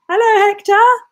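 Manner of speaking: loud, very high hello; high-pitched greeting. Real, not acted. Streamer greeting a new viewer, high-pitched and loud. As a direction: sing out a high, loud welcome.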